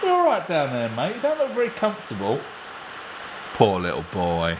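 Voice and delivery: high, sing-song pet voice; doting dog talk. Real, not acted. Owner checking on his dog on the floor, doting. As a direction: coo at the dog, pitched right up.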